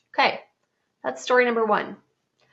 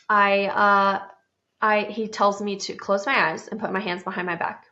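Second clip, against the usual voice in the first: ordinary talk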